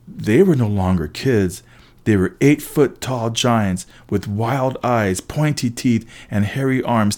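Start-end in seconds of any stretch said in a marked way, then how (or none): none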